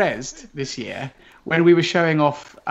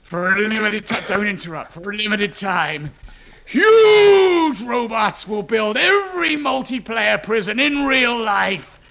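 trailer voice; 5 semitones higher than usual. Pretend. Developer finishes the mock trailer, hammed-up announcer. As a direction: pump it up like an ad climax.

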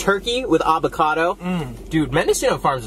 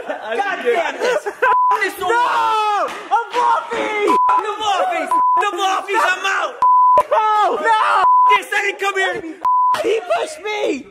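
high shouting; much slower than usual. Pretend. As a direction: shout it high in mock outrage. Mock outraged award reaction.

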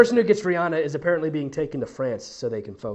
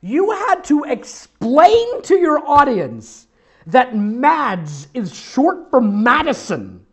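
loud, high, slow and drawn out; mocking exasperation; 4 semitones higher, 6 dB louder, slower than usual. Real, not acted. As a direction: stretch each word in mock disbelief. Mocks the writer's needless explanation.

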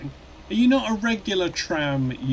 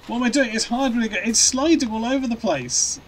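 higher, louder alarmed outburst; panicked; 8 semitones higher, 4 dB louder than usual. Real, not acted. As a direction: yelp it as the truck slides. Truck sliding out of control.

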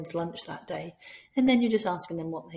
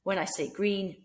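normal talking voice

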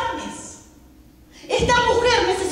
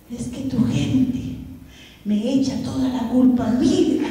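lightly acted low, slow complaint; aggrieved devil; 6 semitones lower than usual. Devil explains his tears to the pastor, mock-aggrieved and low. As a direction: start the grievance low and drawn out. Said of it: "por qué estás llorando entonces".

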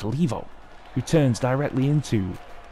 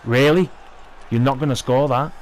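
exasperated; 3 semitones higher than usual. Real, not acted. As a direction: vent at the teammate who missed. Exasperated at missed chance.

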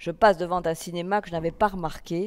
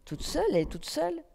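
high, soft teasing singsong; mocking jeer; 11 semitones higher, 4 dB quieter than usual. A narrator's reading. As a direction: taunt her from across the room, much higher. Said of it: "colibets en susurre". (hommes du café raillant la cliente, voix haute et moqueuse)

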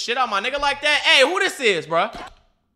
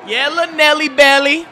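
loud, high hype call; excited cheer; 6 semitones higher, 10 dB louder than usual. Real, not acted. Viewer cheering little Nelson, excited cheer. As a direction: cheer him on at full voice.

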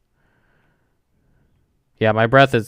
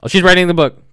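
excited; 9 semitones higher, 8 dB louder than usual. Real, not acted. Ghost seems to answer.

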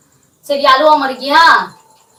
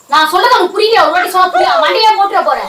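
raised, high-pitched exasperated threat; angry scolding; 7 semitones higher, 4 dB louder than usual. Acted. Prankster arguing over taking the dog away, angry scolding. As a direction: snap loudly, patience gone.

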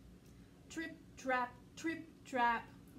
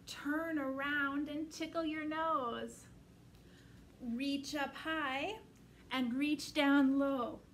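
slow sing-song action rhyme; children's rhyme; slower than usual. Shown as a demonstration. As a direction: chant it slowly for little ones. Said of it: "finish off with our rhyme".

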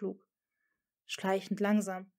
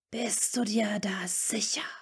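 slow, unvoiced, menacing whisper; the Dark Lord questioning Draco in an angry hiss. A narrator's reading. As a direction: hiss the doubt like a threat. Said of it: "zischte Voldemort ein weiteres Mal".

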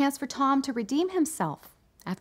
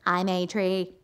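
Stephen Hawking as a tree; 4 semitones lower, slower than usual. Acted. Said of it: "do a Stephen Hawking impression of a tree".

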